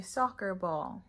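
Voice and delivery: slow, clear model word. Shown as a demonstration. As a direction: say it slowly for the child to copy. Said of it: "You have to say".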